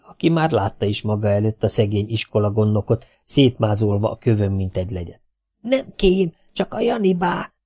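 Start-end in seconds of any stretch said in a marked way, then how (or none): 5.6-7.5 put-on high girl's voice. Vi explains in high voice.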